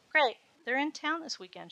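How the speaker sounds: light up with eager news; excited. Acted. Natalia telling her maid about the concert, excited. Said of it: "she said excitedly".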